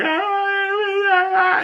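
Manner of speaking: high-pitched mock wail; imitated crying. Kid overwhelmed at meeting Peacemaker, high mock crying. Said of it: "he starts crying".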